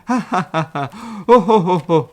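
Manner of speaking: loud booming ha ha ho ho; hearty laugh. A narrator's reading. Elephant bursts out laughing.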